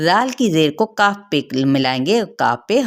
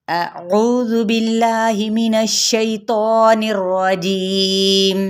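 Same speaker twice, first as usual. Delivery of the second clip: slow, drawn-out melodic Quranic recitation; 5 semitones higher, much slower than usual. Acted. Tajweed teacher recites the verse for students, slow melodic chant. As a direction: chant each word slowly with tajweed.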